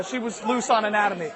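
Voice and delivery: ordinary talk, nothing marked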